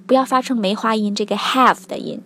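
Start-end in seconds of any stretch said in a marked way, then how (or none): none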